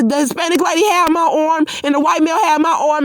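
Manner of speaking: usual speaking voice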